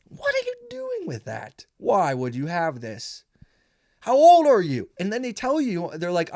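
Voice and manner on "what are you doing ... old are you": mock disbelief. Mock outrage at old comedian.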